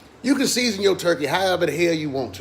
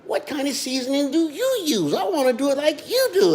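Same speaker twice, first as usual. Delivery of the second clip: mock viewer voice; 9 semitones higher than usual. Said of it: "y'all sitting there like".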